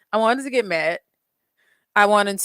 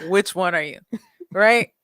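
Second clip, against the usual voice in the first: ordinary delivery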